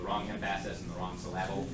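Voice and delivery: "emphasis" as em-PHA-sis, "syllable" as syl-LA-ble, quietly; soft mispronounced "emphasis" and "syllable", second syllables stressed; wrong-syllable stress. Shown as a demonstration. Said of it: "I changed the emphasis on the different syllable".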